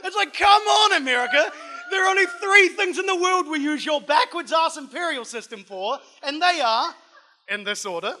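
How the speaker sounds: loud, high mock-angry rant at America; comic rant. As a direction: shout the complaint, half laughing.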